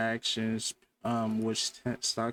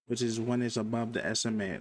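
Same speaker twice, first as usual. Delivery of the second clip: ordinary delivery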